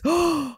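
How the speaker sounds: sharp gasp of delight as the idea hits; a quick thrilled intake of breath; excited gasp. Real, not acted. Host gasps at her own new idea, excited.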